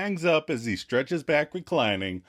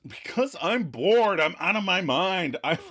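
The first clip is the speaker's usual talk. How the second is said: whining; 6 semitones higher than usual. Retold or imitated. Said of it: "So Ross whines".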